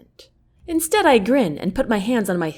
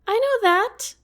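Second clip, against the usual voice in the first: much higher, nervous pitch. Acted. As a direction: squeak it out, trying to sound sure. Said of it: "I say in a high tone".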